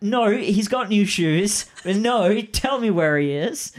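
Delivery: high, loud mock protest; whiny-insistent send-up; mock demanding player. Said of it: "And you're like".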